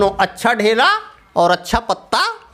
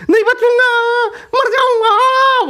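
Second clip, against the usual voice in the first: much higher, slow terrified cry; panicked wail; 4 dB louder, slower than usual. Acted. Scared leaf cries out to his friend. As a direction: wail as if about to die. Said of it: "बोलने लगा पत्ता".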